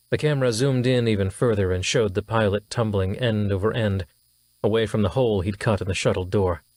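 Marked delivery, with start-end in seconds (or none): none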